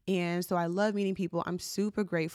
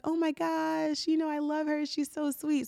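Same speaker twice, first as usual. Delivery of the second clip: retold gushing in a high voice; 8 semitones higher than usual. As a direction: gush over the teacher sweetly. Friend gushing about teacher, retold.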